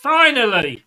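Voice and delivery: relieved exclamation. Real, not acted. Fan greeting the long-awaited substitution, relieved shout. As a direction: at last, throw it out.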